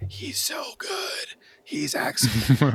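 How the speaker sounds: breathy high gush of praise; gushing fan voice. Retold or imitated. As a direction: swoon over him, almost whispering. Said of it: "I'm like".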